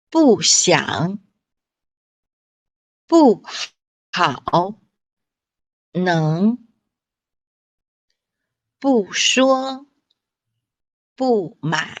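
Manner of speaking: 不想 不好 不能 不说 不买, each 不 said as a falling fourth tone; fourth tone 不 demo. Shown as a demonstration. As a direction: read each 不 falling sharply: bù. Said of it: "which are read fourth tone".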